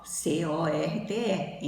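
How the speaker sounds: slow, deliberate letter-by-letter spelling. Shown as a demonstration. Teacher spelling a word for students, slow and separate. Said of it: "Aí é a escrita que é igual".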